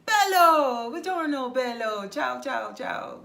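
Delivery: loud, high Italian greeting with falling calls; hammed-up Italian bello ciao; Italian men greeting. Men hailing each other in Italian, loud and high. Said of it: "men say to each other".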